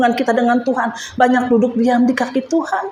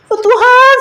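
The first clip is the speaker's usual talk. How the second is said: high, loud wail to God, re-enacted; retold tearful cry; 8 semitones higher, 6 dB louder than usual. Speaker calls on God during prayer, tearful retelling. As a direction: cry out as if close to tears. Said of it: "saya semakin nangis".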